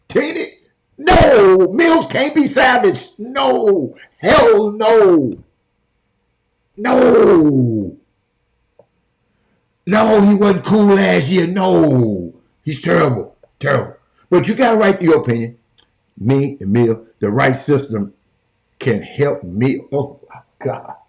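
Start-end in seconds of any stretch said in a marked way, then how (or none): 1.0-8.0 high, loud, shouted denial; heated shout. Real, not acted. Host shouting down a caller's praise of Mills, heated. 19.9-21.0 exasperated whisper under the breath. Real, not acted. Host in a hotel room, whispering in exasperation.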